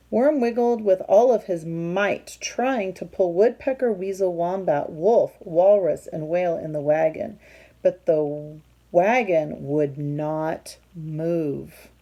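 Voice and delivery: loud, lively storybook read-aloud; reading to children. A narrator's reading. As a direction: read it out big for the kids. Reading picture book aloud.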